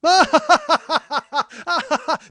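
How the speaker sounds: loud, high mock guffawing; imitated uproarious laughter. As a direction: burst out like two guys who can't stop laughing. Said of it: "I just hear two guys just go".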